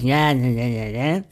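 low, grumpy muttered imitation; snippy cashier. Cashier to customer after scanning the vouchers, snippy imitation. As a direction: mimic a curt, dismissive cashier. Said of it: "sagt sie dann ganz pampig".

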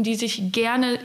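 ordinary talk, nothing marked

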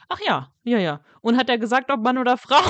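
mock-eager suspect blurting out; eager Rehbein. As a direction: Rehbein voiced as too keen, mildly hammed. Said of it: "Und der so".

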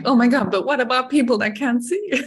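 a laughing Oh my God, half crying; laughing surprise. Retold or imitated. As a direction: blurt it out through laughter, pitch up. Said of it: "And then I'm like".

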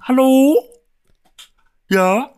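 loud, high call of hello; calling through door. Retold or imitated. Neighbour knocking calls hello, loud and high.

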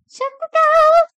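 high, slow, squeaky goodbye rhyme; sing-song it up high like a cartoon; playful squeaky farewell to listeners; squeaky sign-off. Real, not acted.

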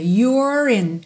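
"You're in" with a rolled R joining "in"; rolled R link. Shown as a demonstration. Teacher modelling the linked R in "You're in". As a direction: roll the R of "You're" right into "in". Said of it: "R da porta com a perna esquerda".

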